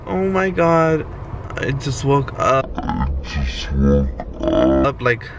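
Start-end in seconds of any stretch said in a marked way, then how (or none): 2.8-4.9 much lower and slow, dragged out; deep slow voice. Shown as a demonstration. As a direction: drop into a deep sleepy drawl. Repeats line in new voice.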